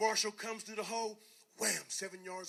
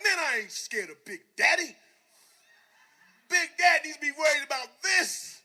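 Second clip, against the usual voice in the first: loud boastful shout; fake bravado; 6 semitones higher, 13 dB louder than usual. Player boasting to teammates, a loud defiant shout. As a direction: puff up and yell to hide the fear. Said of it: "I'm like".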